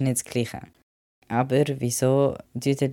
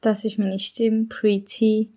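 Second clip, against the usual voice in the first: clearly higher, voice before testosterone; the higher old voice from before the transition; pre-T voice; 9 semitones higher, slower than usual. Shown as a demonstration. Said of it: "da kommt jetzt der Stimmvergleich". (deutlich höhere Stimme vor Testosteron)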